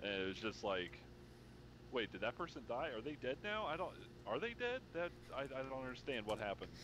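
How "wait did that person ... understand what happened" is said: higher, stumbling puzzled questions; bewildered confusion. Retold or imitated. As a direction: act out a lost, confused viewer. Acting out confusion while watching film.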